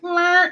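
a high, sour "Meh" like a bad piano key; wrong note imitation. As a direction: mimic a clunky wrong note. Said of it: "somebody hit the".

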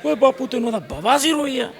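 high, carrying voice of a tactless son; acted loud, high-pitched announcement; loud-mouthed son. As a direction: blurt it loudly at your father. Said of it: "ਉੱਚੀ ਤਰ੍ਹਾਂ ਬੋਲਦਾ".

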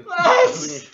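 hohes, ungläubiges Was mit Lachen; fassungslos. Retold or imitated. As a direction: spiel übertriebenen Schock, lachend. Reaktion auf zwei Mbit Upload. (lachend entsetzt)

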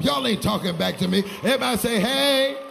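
loud call to the congregation; preacher's loud rallying shout. Real, not acted. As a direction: belt it out to get a response. Preacher demands congregation respond.

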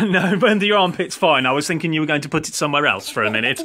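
normal talking voice

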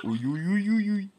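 high, loud rising squeals of delight; excited squeal. Real, not acted. Gamer watching the character wobble off, squealing. Character flails across the screen.

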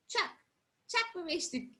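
ordinary delivery

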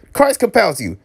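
high, alarmed exorcist cry; mock exorcism. Reader warding off Red Devils on screen, mock exorcism.

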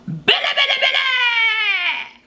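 high laughing cry of the name; excited laughing shout. Real, not acted. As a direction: shriek the name with delight. Reacting to song, shouting.

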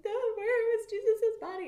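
high, loud distressed woman; Mary upset. Retold or imitated. Mary asks the stranger, high and upset. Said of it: "And she's like".

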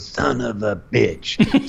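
slow, muttered movie line; gruff Clint quote. Retold or imitated. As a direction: drag it out slowly. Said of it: "And he goes".

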